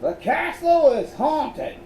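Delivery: put-on high, loud old southern colonel accent. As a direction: boom it out like an old Southern gentleman. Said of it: "Colonel Beauregard's voice continued".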